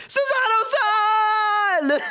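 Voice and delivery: very high, drawn-out mock wail; anguished scream. Streamer wailing over seemingly dead Susato, anguished scream.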